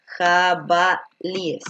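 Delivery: jabalíes stretched out slowly, each syllable drawn; slow teaching demo of the plural. Shown as a demonstration. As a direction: spell out jabalíes slowly for the class. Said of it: "lo correcto sería".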